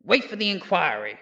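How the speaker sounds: normal talking voice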